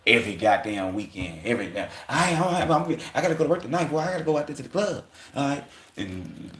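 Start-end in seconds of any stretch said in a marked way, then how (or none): 2.1-5.6 higher, quick mock voice of a guy heading out; imitating a guy. Guy telling his girl he's off to work, in a higher mock voice. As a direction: play the guy bragging he's off to the club, pitched up. Voicing a guy leaving for club.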